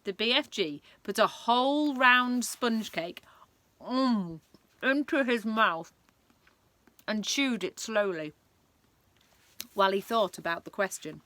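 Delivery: ordinary delivery, nothing marked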